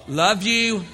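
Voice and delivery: John greeting a friend with loud gushing affection; loving John. Acted. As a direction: gush like an overeager hugger. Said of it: "John was a loving".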